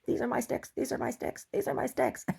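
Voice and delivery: high, chanting talking dog; dog's voice. Acted. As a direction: pitch it way up like a possessive pup. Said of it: "that's what he's telling the dog".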